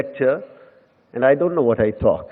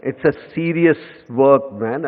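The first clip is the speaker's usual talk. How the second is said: usual speaking voice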